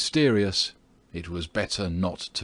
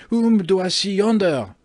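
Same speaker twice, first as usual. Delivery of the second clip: high, loud cry of alarm; startled, raised voice; alarmed exclamation; 12 semitones higher, 7 dB louder than usual. Acted. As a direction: call out in shock at a sudden sighting. Landlord suddenly spots the stranger.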